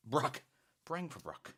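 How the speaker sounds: put-on high, playful Irish accent. Host playing with viewer's phrase, Irish accent. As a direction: put on a little Irish lilt. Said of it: "Little Irish accent there".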